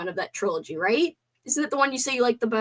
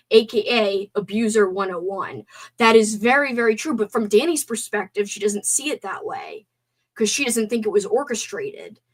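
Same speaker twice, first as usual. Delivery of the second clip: ordinary delivery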